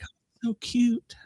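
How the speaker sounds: slow, high, airy mock coo; softly gushing in a whispery high voice; swooning. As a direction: imitate a smitten admirer cooing. Imitating nurses swooning over the accent.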